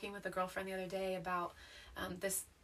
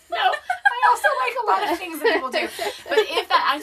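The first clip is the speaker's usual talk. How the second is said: loud laughing reply; 13 semitones higher, 18 dB louder than usual. Real, not acted. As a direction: laugh through the words. Laughing defense after tease.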